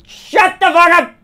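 high slow yell. Real, not acted. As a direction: bark it out slowly, high. Ranting at chat.

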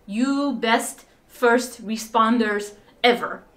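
slow, careful lightly imitated Ukrainian accent in broken English. Old Ukrainian man thanks the team at the door, slow lightly imitated Ukrainian accent. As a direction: measure out each word like a proud old Ukrainian man. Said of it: "comes to the door to us and says".

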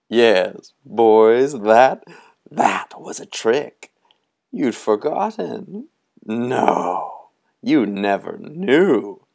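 a hint of a grand, gleeful stranger; tall man's voice. Tall man telling the boys they forgot, lightly voiced.

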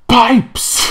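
high, loud hammed-up yell of the word; mock shout. Bowden cueing the bagpiper, big mock shout. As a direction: bellow it like a fight cue. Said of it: "Bowden goes".

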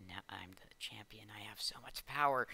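gloating hushed whisper. Acted. New champion gloating to himself, mock-sinister whisper. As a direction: whisper it like a secret power grab.

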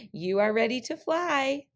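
sly, lifted trickster tone; trickster tale voices. Acted. Old Man Crow tricking Coyote.

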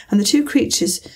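ordinary delivery, nothing marked

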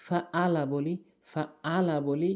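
"ফাআলা" with a plain glottal hamza; hamza for ain. Shown as a demonstration. Teacher showing the common mistake, "ফাআলা" with hamza instead of ain. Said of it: "আইনের জায়গায় হামজা এনে উচ্চারণ করি".

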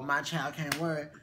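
ordinary delivery

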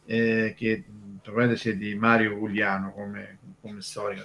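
ordinary talk, nothing marked

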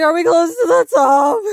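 half-crying question to a passerby; exhausted hiker asking. Retold or imitated. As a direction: whine it up the trail like you're spent. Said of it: "and I was like".

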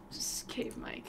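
whispered resignation; whispering. Real, not acted. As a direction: whisper it, giving up. Resigned to echo mic.

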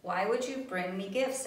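lively storybook reading; gentle picture-book reading voice; reading aloud to children. A narrator's reading. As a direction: read it like story time for kids.

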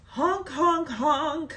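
high mock horn honks; goofy honking noise; playful clown-horn honks; silly honks. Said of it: "That was silly".

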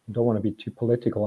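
normal talking voice

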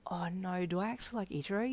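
soft, panicked whisper; internal scream. Acted. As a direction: scream it inwardly, under your breath. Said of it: "you screamed internally".

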